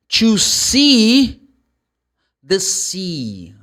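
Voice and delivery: "see" high, loud and drawn out, then "sea" held long; long E sounds. Shown as a demonstration. Teacher models see and sea for learners, long E. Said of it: "make the sound longer".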